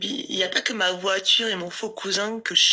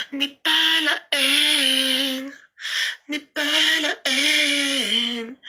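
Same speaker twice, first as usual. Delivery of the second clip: slow, stretched-out sing-song taunt; a higher, mocking drawl; 5 semitones higher, much slower than usual. Real, not acted. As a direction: drag each word out to needle them. Taunting her haters on stream.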